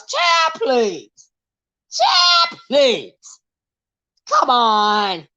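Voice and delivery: scoffing at a rival's cheer; mock disbelief. Real, not acted. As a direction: dismiss it with playful exasperation.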